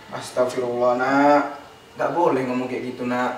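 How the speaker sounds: mother's low, subdued reproach; retold scolding. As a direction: chide a child in a low, softened voice. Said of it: "dia marah". (teguran ibu bernada rendah)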